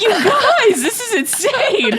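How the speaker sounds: high-pitched mock outcry, last word drawn out; retold exclamation. Reacting to the ladies admiring tour guides, high excited exclamation. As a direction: exclaim it high, half laughing.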